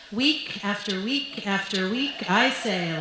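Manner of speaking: normal talking voice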